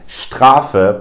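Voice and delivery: STRAFE as "SHTRAH-fe". Shown as a demonstration. Teacher gives an example word, s as sh. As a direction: open German STRAFE with sht. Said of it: "pronounced as a sh".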